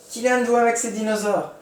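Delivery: high, bright exclaiming tone; excited exclamation. Shown as a demonstration. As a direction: say it thrilled. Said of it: "Ça, c'est exclama". (phrase exclamative enthousiaste)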